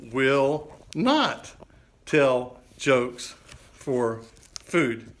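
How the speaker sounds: "Will not tell jokes for food" read very slowly, word by word; teasing reveal of the joke sign. Shown as a demonstration. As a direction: spell out the new sign slowly and deliberately. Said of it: "and it says, can you read that?".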